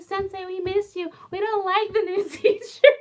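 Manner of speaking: put-on high, loud child voice, pleading and giggling; teacher imitating her pupils begging her to stay; imitating whiny kids. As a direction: squeak like small kids who miss their teacher.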